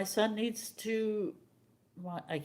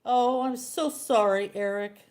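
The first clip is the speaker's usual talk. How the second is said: mock apology; 5 semitones higher, 9 dB louder than usual. Mocking apology to angry son.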